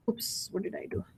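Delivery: a quick breathless whisper; whispered oops. Real, not acted. Teacher catching his own typo, whispering to himself. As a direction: hush it to yourself like a small mistake. Coding slip, aside to himself.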